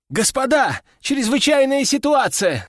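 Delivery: high-pitched alarmed squeal; excited young soldier, pitch way up. A narrator's reading. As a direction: burst in squealing with urgent news. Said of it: "заверещал паренёк".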